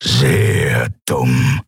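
a low, lightly voiced lion brute; a captor taunting the beaten prisoner in a low voice; Graufell's menace. A narrator's reading. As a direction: growl it low and slow with menace. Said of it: "Graufelds Worte".

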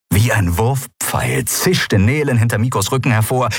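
ordinary delivery

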